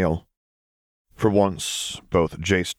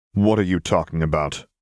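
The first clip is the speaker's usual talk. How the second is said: usual speaking voice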